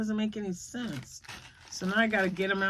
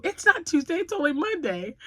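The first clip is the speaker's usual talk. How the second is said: mock insistence; 10 semitones higher, 4 dB louder than usual. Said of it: "I, I go".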